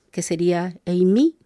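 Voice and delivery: ordinary talk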